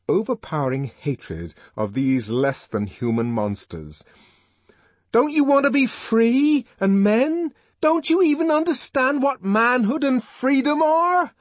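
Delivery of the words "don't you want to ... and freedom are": very high, loud impassioned harangue; enraged, pleading shout; fluent rage. A narrator's reading. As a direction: rail at them in a rush of outrage. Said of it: "Rage was making him fluent".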